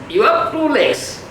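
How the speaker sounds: usual speaking voice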